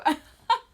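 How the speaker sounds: sudden loud squeal of laughter; loud high laugh. Real, not acted. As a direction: crack up at the joke. Laughing at own joke.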